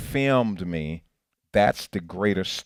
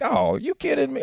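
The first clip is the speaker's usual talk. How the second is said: exasperated; 4 dB louder than usual. Retold or imitated. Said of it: "It's like".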